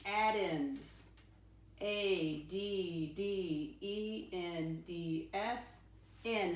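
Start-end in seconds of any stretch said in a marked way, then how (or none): 1.8-5.8 slow letter by letter spelling; spelled out slowly. Shown as a demonstration. Teacher spelling a word for her class, slowly. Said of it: "How do I spell can?".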